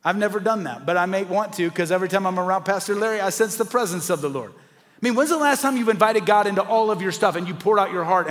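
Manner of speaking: ordinary talk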